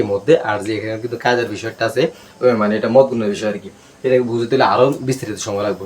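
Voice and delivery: ordinary talk, nothing marked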